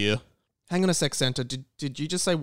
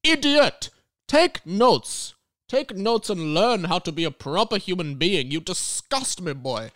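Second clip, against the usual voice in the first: angry scolding; 4 semitones higher than usual. Acted. Santa scolding the intern for no pen, angry. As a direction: bark at the useless intern.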